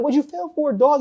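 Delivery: high, mock-innocent asking; innocent question. Asking the failed Marine, high mock innocence. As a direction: feign ignorance to the failed Marine. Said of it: "I'm like".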